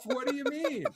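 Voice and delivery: breaks into a high laugh mid-question; giggling while asking. Retold or imitated. Laughing mid-quote at the club.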